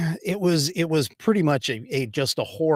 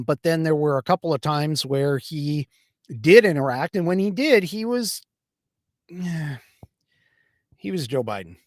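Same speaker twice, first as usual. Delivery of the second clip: usual speaking voice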